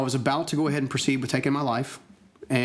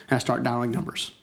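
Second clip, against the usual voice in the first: ordinary talk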